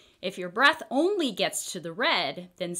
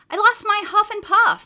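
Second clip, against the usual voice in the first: put-on much higher, louder eager kid; young boy voice; 13 semitones higher, 10 dB louder than usual. A narrator's reading. Boy tells the pharmacist he lost his breath, high kid voice. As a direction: play the little boy, earnest and loud.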